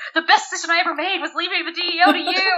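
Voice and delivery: high-pitched gushing imitation; acted affirmation. Father affirming his daughter, high gushing imitation. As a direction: gush it as mock heartfelt praise.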